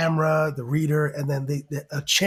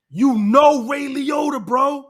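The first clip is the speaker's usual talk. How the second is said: excited realization; 7 semitones higher, 5 dB louder, slower than usual. Real, not acted. Realizing who attacked him.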